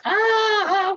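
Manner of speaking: hammed-up high singing wail; mock high belt. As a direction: belt a high note like the diva. Imitating [name]'s high note.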